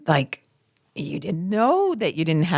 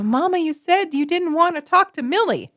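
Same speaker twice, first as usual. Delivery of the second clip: higher-pitched, lightly voiced kid's protest; child blurting out; 9 semitones higher than usual. Innocent child calling out mother about Millie, lightly acted blurting. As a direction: blurt it like an indignant little kid. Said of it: "you might even blurt out".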